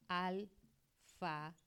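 "Alfabeto" drawn out slowly, syllable by syllable; slow teaching demo. Shown as a demonstration. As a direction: stretch "Alfabeto" slowly so the parts are heard. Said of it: "Porque alfabeto tiene dos palabras".

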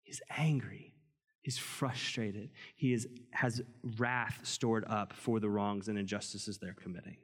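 intense whisper. Real, not acted. Earnest point about God's anger.

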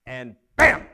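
a loud, punchy "bam"; dramatic bam. Acted. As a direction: slam down the word "bam" like an impact. Dramatic life-changing moment in the story.

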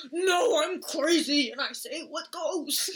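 loud, high hammed-up old preacher; overdramatic priest voice. Retelling a movie, friend voices the driver like a priest. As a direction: ham it up like an old priest declaring. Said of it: "Why did you say it like a priest?".

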